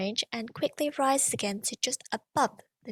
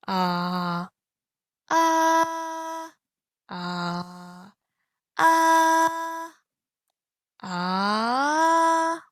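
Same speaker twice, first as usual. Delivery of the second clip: low ah, then high ah; 5 semitones higher, much slower than usual. Shown as a demonstration. Teacher showing the climb from low to high pitch. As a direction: swing from the bottom to the top. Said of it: "go all the way up to the high pitch".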